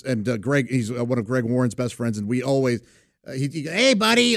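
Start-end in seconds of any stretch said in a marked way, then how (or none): 3.6-4.4 imitating Biggerstaff. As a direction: do your buddy's way of saying hi. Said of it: "he talks like that".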